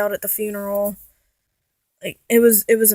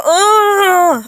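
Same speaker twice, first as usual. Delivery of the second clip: imitating a confused moan; 9 semitones higher, 7 dB louder than usual. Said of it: "he would just go".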